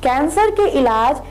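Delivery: upbeat radio ad voice; ad read. Acted. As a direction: sell it like a local ad. (Radio विज्ञापन वाली आवाज़)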